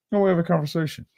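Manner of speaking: ordinary talk, nothing marked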